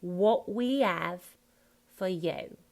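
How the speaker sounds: slow "have" as ave; dropped H. Shown as a demonstration. Coach repeating the phrase for the actor, dropped H. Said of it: "drop that H".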